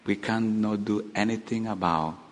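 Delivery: low, soft resignation; resigned. Acted. Pharisees admitting they can do nothing, quietly resigned. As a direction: give up quietly, voice dropped.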